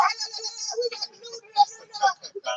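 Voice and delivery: high, soft mocking singsong la la. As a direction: mimic her airy babble. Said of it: "you know the way she talk".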